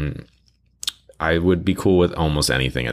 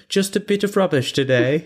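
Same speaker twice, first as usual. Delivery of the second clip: British customer echo; 11 semitones higher than usual. Retold or imitated. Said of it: "some British people that come in".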